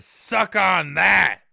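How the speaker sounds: loud, high, drawn-out taunt; defiant taunting shout. Acted. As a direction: shout it slowly, rubbing it in. Voicing the band's defiant message.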